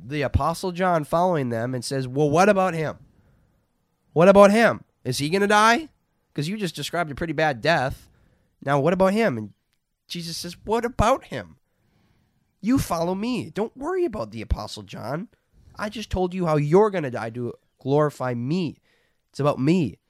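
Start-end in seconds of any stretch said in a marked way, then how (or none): 2.1-9.4 loud, high acted complaint; indignant protest. Acting Peter's indignant protest. 10.6-20.0 high, emphatic scolding as Jesus; acted rebuke. Acting Jesus rebuking Peter.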